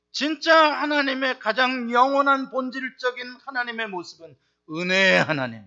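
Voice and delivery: normal talking voice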